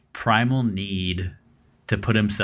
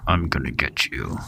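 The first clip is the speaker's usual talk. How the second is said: low imitated menacing growl; clenched-teeth growl; 4 semitones lower than usual. Imitating how victims describe the prowler speaking, a low clenched-teeth growl. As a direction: growl it through clenched teeth, mimicking a threat. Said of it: "a growl with clenched teeth".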